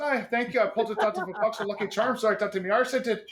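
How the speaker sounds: a loud, hammed-up fake Irish brogue. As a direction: lay the bullshit Irish accent on thick. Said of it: "I laid that bullshit Irish accent on her thick".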